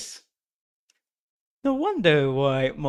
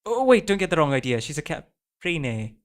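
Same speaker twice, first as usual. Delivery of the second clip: ordinary delivery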